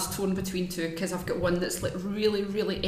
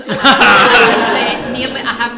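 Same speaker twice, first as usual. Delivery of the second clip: laughing; 5 semitones higher, 17 dB louder than usual. Real, not acted. Laughing while confessing she loves the podcast.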